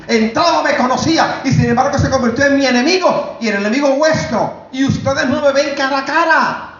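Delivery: high, insistent preacher's outcry; imitated divine cry. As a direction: cry it out as God's reproach, pitched high. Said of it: "el Espíritu Santo me gritó".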